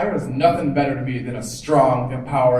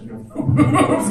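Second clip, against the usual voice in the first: high, drawn-out, louder drag queen taunt; mock drag queen; 14 semitones higher, 7 dB louder, much slower than usual. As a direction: imitate a drag queen, laughing and stretching it out high. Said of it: "drag queen went up to a straight woman and said".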